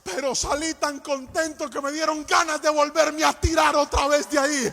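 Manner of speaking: loud, driving exhortation over music; fiery sermon climax; full-force preaching. Acted. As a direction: urge the church not to fear.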